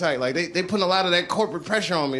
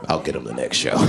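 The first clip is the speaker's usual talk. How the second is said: much lower, growled threat; Kramer muttering revenge. Retold or imitated. Kramer vowing revenge after the set, deep growl. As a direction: grumble a grudge deep and low. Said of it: "he was thinking".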